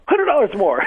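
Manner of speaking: excited bid. Retold or imitated. Eager first bid at auction.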